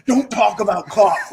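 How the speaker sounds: a tipsy Bostonian suddenly coming out; buzzed Boston accent. Retold or imitated. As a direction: roommate gets buzzed and his Boston accent takes over. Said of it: "he would start".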